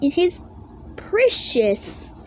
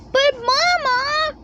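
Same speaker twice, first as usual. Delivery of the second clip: put-on high, slow pleading child voice; high cub voice protesting; 12 semitones higher, slower than usual. A narrator's reading. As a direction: whine it like a cub begging Mama. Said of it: "they protested".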